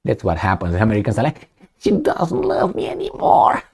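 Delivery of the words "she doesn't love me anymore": high, whispery whine of a jilted lover; a hammed-up heartbroken whine, much higher; mock jilted lover. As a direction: whimper it like a heartbroken sap. Americans voiced as jilted lover.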